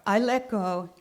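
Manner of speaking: ordinary talk, nothing marked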